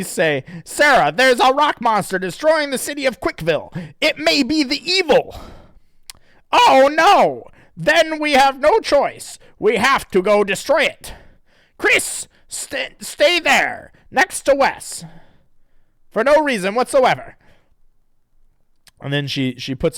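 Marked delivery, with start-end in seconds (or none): 0.6-5.4 hammed-up high, loud heroine; high cartoon girl voice. Character voice in comic reading. 6.5-17.4 loud, high mock-heroic resolve. Character voice in comic reading.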